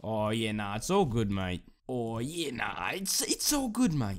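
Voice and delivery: exaggerated Aussie accent with swooping pitch; Australian accent. Shown as a demonstration. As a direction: ham up a chummy Australian. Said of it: "That's how you do an Australian accent".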